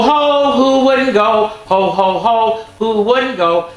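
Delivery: plain reading voice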